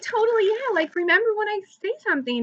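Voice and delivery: high-pitched imitated agreement; eager fans. Fans agreeing to send a comment, imitated eagerness. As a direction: mimic keen fans, voice lifted.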